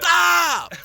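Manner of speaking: sharp loud high stop; shouted stop. Retold or imitated. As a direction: cut the scene with a yell. Professor cutting the scene.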